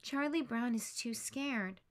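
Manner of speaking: plain reading voice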